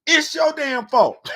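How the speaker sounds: slow, high, loud mock blame; mock shout. Comic yelling blame at the crowd. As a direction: bellow it like an accusation.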